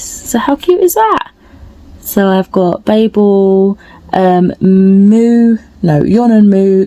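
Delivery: high, slow squeal of delight; excited. Real, not acted. Reading out her book picks to viewers, delighted. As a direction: coo over the tiny printout. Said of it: "I'm getting a bit excited".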